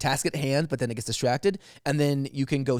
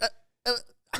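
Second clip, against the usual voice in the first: stammering Tony; 12 semitones higher, 3 dB quieter than usual. Acted. Tony glitching on zoom.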